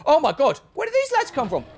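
shrill high panicked shout; loud high squeal of alarm. Real, not acted. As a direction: caught off guard by a mob. Ambushed by enemies in game.

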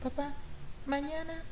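put-on high, soft, timid child calling her father; small scared girl knocking at her father's door; little girl voice. Girl softly calling her father through the locked door.

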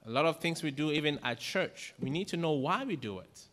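usual speaking voice

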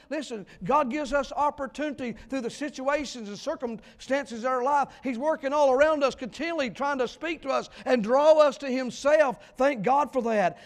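preacher exhorting his church at full force; fiery preaching. Acted. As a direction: drive each point home to the congregation.

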